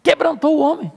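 squealing with delight over his own sermon; excited pastor. Retold or imitated. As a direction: gush like the proud preacher. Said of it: "ficou todo empolgado".

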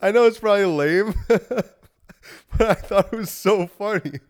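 giggling high and loud through the words; real laughter at a silly joke; laughing. Real, not acted. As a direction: crack up while you talk. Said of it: "I just think that's so funny".

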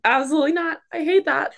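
high-pitched, adamant no; mock-horrified refusal when put on the spot; emphatic refusal. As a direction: refuse outright, voice jumping up. Acting out refusal to sing.

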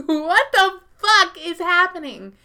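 high, loud incredulous outburst; mock disbelief. Narrator reacting to Grandpa's news, loud high disbelief. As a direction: sell the shock with a laugh.

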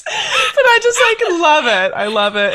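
laughing. Real, not acted. Gushing over friend's all-caps text.

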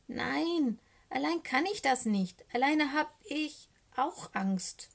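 high, desperate little raven wail. A narrator's reading. Little raven admits he is afraid, desperate. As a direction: plead high, slowing toward the end. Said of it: "ruft der kleine Rabe verzweifelt".

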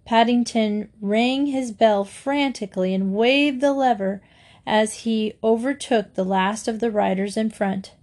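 ordinary delivery, nothing marked

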